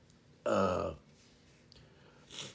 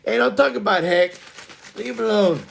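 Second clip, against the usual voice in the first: raised, higher-pitched mock scolding; playful loud telling-off; mock protest; 8 semitones higher, 7 dB louder than usual. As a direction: loudly leap to his defense in play. Mock defence of teased Heck.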